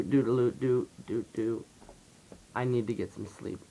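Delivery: ordinary delivery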